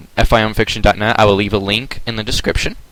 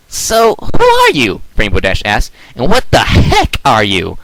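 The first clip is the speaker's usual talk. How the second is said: brash Rainbow Dash; 4 dB louder, slower than usual. Acted. Rainbow Dash questioning creature.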